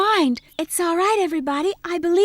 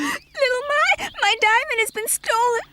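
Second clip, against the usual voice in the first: tearful; 5 semitones higher than usual. Acted. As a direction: sob out the bad news. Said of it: "why are you crying?".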